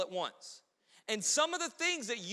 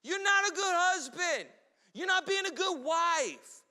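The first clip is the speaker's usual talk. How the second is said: mock quarrel; 7 semitones higher than usual. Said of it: "We go into it".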